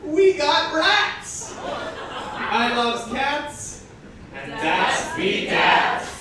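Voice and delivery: slow, drawn-out mock dialect verse with laughs breaking through; slow goofy verse, laughing through it; comic poem reading. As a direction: read the silly cat poem slowly, fighting the giggles.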